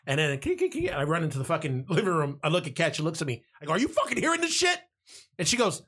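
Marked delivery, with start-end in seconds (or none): 0.4-0.9 high squeaky kee chirps; cricket chirp imitation. 3.7-4.8 high, fast, incredulous; excited. Retold or imitated. Excited question to girlfriend.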